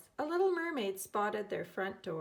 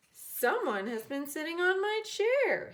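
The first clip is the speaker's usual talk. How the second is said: high, loud declaration; booming indignant father; announcing; 7 semitones higher, 5 dB louder than usual. A narrator's reading. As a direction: proclaim it to the room. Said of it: "he announced".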